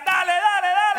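high, loud cheering yell; shouting coach. Retold or imitated. Interpreter echoing the coach's yell, loud. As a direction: push the kids on, loud. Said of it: "Y les decía".

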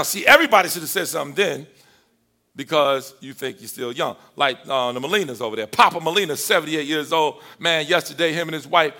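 ordinary delivery

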